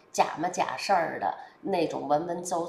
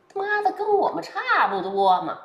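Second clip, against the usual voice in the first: much higher and louder, a blunt working-class voice; voicing a fed-up voter; 12 semitones higher, 6 dB louder than usual. Middle-class voter cursing with relief at a candidate like them. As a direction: blurt it out like an ordinary guy recognising himself.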